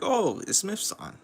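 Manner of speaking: high and slow, stunned; a drawn-out gasp of recognition; mock surprise. Couple caught by Smith-san, slow stunned surprise. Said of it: "it's like".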